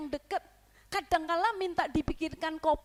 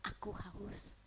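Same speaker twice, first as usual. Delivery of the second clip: soft whispered request; 6 semitones lower, 13 dB quieter, slower than usual. Acted. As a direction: breathe it faintly. Jesus whispering thirst. (bisikan Yesus)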